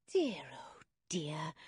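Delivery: slow, breathy-free whispered sigh; fond whisper. A narrator's reading. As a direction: whisper it with a fond shake of the head. Mother sighs fondly over father.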